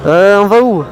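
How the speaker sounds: talking loud inside a helmet while riding. Real, not acted. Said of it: "on se retrouve tout de suite dans le casque".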